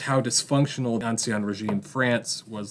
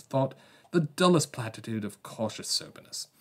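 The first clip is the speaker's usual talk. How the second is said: a self-mocking, hammed-up English accent, somewhat higher; awful English accent; 3 semitones higher than usual. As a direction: read the Victorian editor in a deliberately bad English accent. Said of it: "awful English accent here".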